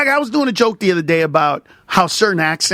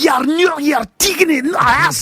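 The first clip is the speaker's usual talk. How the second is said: put-on terrible Braveheart Scottish accent, pitched high; bad Scottish accent; 8 semitones higher than usual. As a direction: ham up a awful Scottish brogue. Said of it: "the worst Braveheart accent you've ever heard".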